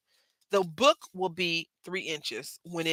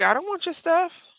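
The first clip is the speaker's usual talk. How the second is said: petulant retort; 12 semitones higher, 4 dB louder than usual. Retold or imitated. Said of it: "I was like".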